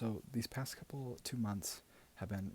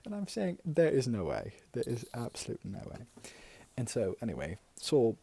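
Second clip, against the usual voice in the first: gushing auntie voice; 2 semitones higher than usual. Acted. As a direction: play a doting, gossipy aunt. Plays a gushing auntie character.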